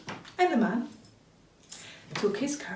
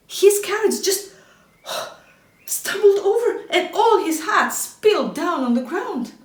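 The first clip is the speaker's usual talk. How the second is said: higher, louder with gasps and a laugh; excited storytelling; 7 semitones higher, 6 dB louder than usual. A narrator's reading. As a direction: tell the crash breathlessly. Carriage tips over, dramatic.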